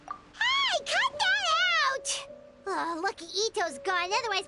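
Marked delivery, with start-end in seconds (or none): 0.1-2.3 high, drawn-out indignant cry; high-pitched objection, dragged out; indignant protest. Acted. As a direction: whine it out in protest, high and stretched.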